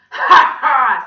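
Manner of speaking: very loud, high gloating laugh; booming evil ha-ha; villain's cackle. Acted. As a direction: laugh cruelly in a monster voice. Villain gloats after cruel call.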